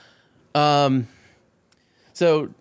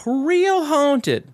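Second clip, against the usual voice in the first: mock-spooky emphasis; 14 semitones higher than usual. Real, not acted. Comic retelling, drawn-out high word.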